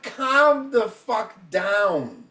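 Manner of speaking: each word dragged out slowly, fed up; slow scolding. Retold or imitated. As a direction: spell it out slowly like scolding a grown man. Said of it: "There, I'm like".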